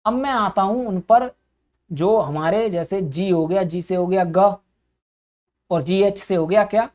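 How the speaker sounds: usual speaking voice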